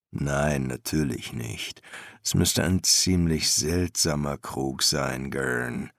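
low, soft master's voice; subdued master embalmer; master embalmer, patient. A narrator's reading. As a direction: correct the apprentice low and patiently.

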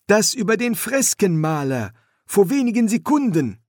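put-on high, eager young man; young son voice. Son's voice in story.

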